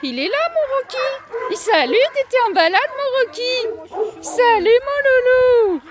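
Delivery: high, sing-song coo to each dog. Real, not acted. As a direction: coax the dogs like babies, high and bright. Finds dog Rocky.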